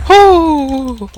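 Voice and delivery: loud, high whoop of relief; triumphant yell after a close call; excited whoop. Real, not acted. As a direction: cheer like you just escaped death. Cheering after surviving attack.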